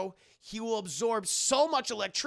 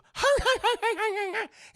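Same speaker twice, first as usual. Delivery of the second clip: high, rapid yapping laugh; Dane DeHaan; 14 semitones higher, 8 dB louder than usual. Acted. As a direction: cackle like a barking chihuahua. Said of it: "he sounds like a chihuahua".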